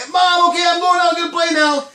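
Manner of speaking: high, loud holler on the way out the door; loud, high-pitched announcement to a parent; kid calling to mom. Retold or imitated. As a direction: call it through the house in a hurry to leave.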